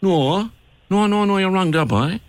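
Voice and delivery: high-pitched Cork pub comeback; Cork man's retort. Acted. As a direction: play a Cork fella correcting the Dubliner. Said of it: "the two fellas met in a pub".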